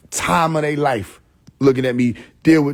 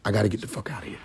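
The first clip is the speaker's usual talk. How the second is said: much softer, low muttered panic; muttered escape plan; 5 semitones lower, 10 dB quieter, much faster than usual. Acted. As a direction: say it under your breath to yourself.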